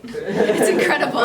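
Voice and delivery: loud, laughing retold gush; cracking up through the words. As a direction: burst into laughter while quoting an excited friend. Laughs while voicing her friend.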